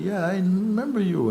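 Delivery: insistent recognition. Retold or imitated. Tourist insists he remembers.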